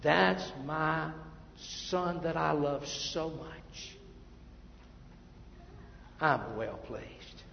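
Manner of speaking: slow, soft "That's my son that I love so much"; tender slow praise. Retold or imitated. Father quietly telling his son he is proud, soft and slow. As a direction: say it tenderly, drawing out the words. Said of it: "I said".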